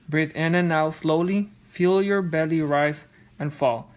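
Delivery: plain reading voice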